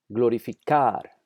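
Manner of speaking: Glorificar slowly, stressing final "car"; emphasized stressed syllable. Shown as a demonstration. Teacher demonstrating prosodic accent, slow Glorificar stressed on "car". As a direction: draw out the last syllable of Glorificar. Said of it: "Note que hay un énfasis de pronunciación".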